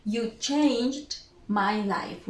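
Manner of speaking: ordinary talk, nothing marked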